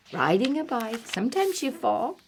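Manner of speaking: ordinary delivery, nothing marked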